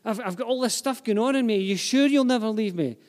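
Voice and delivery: high, loud, anxious questioning; needing reassurance. Acted. Doubting believer asking God for reassurance, anxious and insistent. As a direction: plead to be told it is sure. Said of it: "some people need a lot of reassurance".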